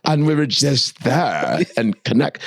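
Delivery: a hint of a starry-eyed celebrity. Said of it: "She's like".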